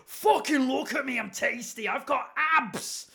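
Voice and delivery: mock Northern English brag, high and slow; a cocky Northern English bloke showing off, slow and high; northern accent. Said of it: "he's gone really northern".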